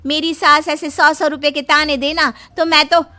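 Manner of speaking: loud, sharp talking back; sister-in-law loudly talking back to her mother; loud retort. Acted.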